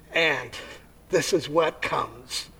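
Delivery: usual speaking voice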